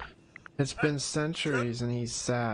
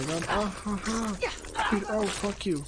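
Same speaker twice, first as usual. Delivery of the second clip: frustrated yell; 9 semitones higher than usual. Real, not acted. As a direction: yell it in disbelief. Frustrated at game enemy.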